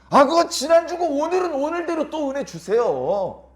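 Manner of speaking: high, slow wheedling voice; cheeky pleading believer. Retold or imitated. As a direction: coax God shamelessly. Believer answering God, cheeky. (높고 느리게 조르듯)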